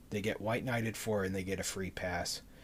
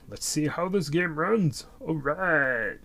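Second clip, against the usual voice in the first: higher, louder and slow, hyped up; excited game start; 8 semitones higher, 9 dB louder, slower than usual. Real, not acted. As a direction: pump up the stream for the new game.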